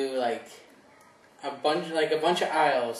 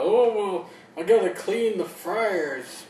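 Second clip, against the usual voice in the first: high, slow whiny mimic; mock whining worker; 4 semitones higher, slower than usual. Mocking a coworker who complains about fryers, slow whine. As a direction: whine it like a spoiled new hire. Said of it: "don't whine to me about".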